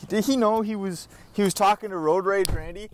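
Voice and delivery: ordinary talk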